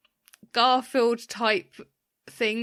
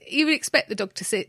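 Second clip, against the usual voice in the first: ordinary talk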